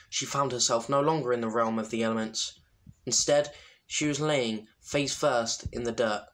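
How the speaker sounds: ordinary delivery, nothing marked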